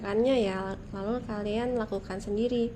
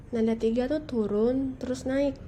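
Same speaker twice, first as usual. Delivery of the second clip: normal talking voice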